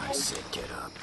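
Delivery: whispered, insistent repeat. Real, not acted. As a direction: hiss it under the breath. Repeats order after shouting.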